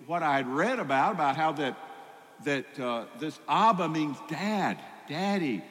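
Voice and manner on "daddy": ordinary talk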